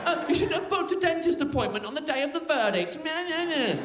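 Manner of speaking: high mocking whiny voice with meh meh; mocking nag. Comic retelling a nagging colleague, high whiny mock voice. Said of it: "He'd come up to me every day".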